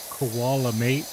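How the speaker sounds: put-on a light Australian accent on "koala mate", with "mate" rising at the end; a playful attempt at an Australian accent; Aussie accent. Asked to impersonate an accent, he tries a light Aussie. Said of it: "Now impersonate Ozzy's regional accent".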